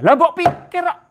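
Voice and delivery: high, loud mock scold; mock exasperation. Preacher teasing a listener who overthinks, mock scold. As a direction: tease them for overthinking.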